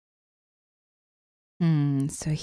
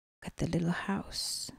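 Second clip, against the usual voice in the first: whisper; 14 dB quieter, faster than usual. Real, not acted. Whispered aside to child.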